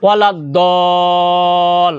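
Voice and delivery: ওয়ালাদ দল chanted slowly with a long held vowel; long tajwid chant of ওয়ালাদ দল; elongated recitation. Shown as a demonstration. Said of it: "চার আলিফ টানতে হবে".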